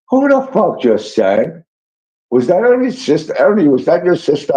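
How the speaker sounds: high, quick, astonished questions; blurting out who just sang; retold disbelief. Shocked at a concert, asking his friend who sang. Stunned by hidden singing talent.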